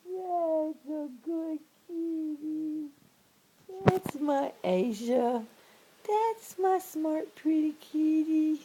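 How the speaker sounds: high, sing-song praise for a kitty; cooing pet praise. Real, not acted. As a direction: coo proudly at a clever pet. Praising cat after it yawns.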